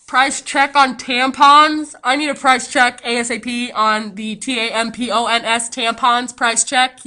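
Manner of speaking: loud, slow intercom call; mock store announcement. Imagined clerk announcing over the intercom, loud and slow. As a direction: blare it over the store speaker. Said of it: "I was nervous that he was gonna go".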